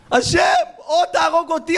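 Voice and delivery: high, pleading shout; desperate shouted prayer. Acted. Heartbroken man begging God to kill him, high shout. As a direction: howl it as a last plea. Same man begs to die.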